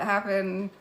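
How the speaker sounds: normal talking voice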